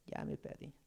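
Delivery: soft breathy mock of a confused student; whispered aside as a confused newcomer; mock lost student. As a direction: play a student who's lost the thread, half-whispered. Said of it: "los que son nuevos probablemente digan".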